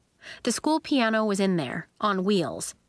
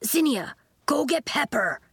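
mildly desperate, higher-pitched order; 6 semitones higher than usual. A narrator's reading. Elliot sends Zinnia for Pepper, desperate. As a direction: urge it with some desperation. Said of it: "Elliot said desperately".

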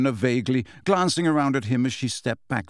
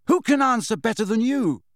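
high-pitched frustrated demand; exasperated rhetorical question; 10 semitones higher than usual. A narrator's reading. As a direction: challenge him, voice pushed up high. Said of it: "said Harry exasperatedly".

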